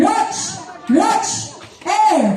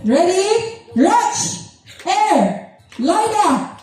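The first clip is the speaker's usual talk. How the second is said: ordinary talk, nothing marked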